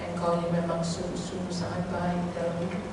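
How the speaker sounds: hushed, low thanks to God; retold reflective prayer. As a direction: murmur gratitude softly, as if musing alone. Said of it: "waktu duduk merenung, saya bilang". (doa syukur lirih sambil merenung)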